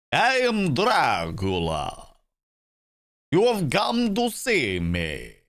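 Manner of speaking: put-on theatrical old-film vampire voice; caricatured Bela Lugosi Dracula. Shown as a demonstration. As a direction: drawl it like a cartoon Dracula. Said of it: "like a caricatured, um, Bela Lugosi".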